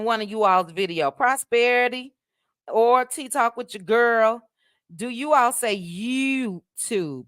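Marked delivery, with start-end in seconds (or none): 5.8-7.2 put-on slow DMV-accent YouTube; imitated DMV accent. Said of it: "I love you all's accent".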